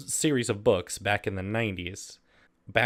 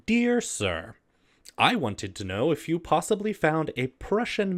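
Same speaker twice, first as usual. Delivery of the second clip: higher, mock-formal letter reading; raised, overly proper read-aloud voice; 3 semitones higher, 3 dB louder than usual. Reads a silly letter aloud.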